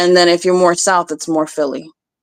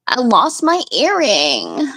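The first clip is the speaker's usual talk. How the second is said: slow, drawled-out celebrity vocal fry; lazy reality-star drawl; Kim Kardashian; 4 semitones higher, slower than usual. Shown as a demonstration. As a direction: drag out every word like Kim Kardashian. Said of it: "Do, do Kim Kardashian".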